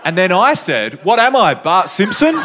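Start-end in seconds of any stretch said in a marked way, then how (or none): 1.0-2.4 mock quip. Self-deprecating quip to class.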